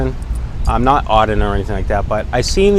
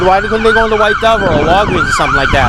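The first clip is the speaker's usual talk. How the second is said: pitched higher, pressing hard; retold argument, voice climbing in exasperation; heated retelling; 9 semitones higher than usual. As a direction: push back at him with rising indignation. Retold confrontation with the man.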